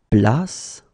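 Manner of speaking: ordinary talk, nothing marked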